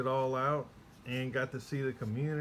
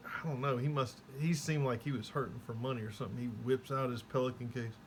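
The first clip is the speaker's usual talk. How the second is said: ordinary talk, nothing marked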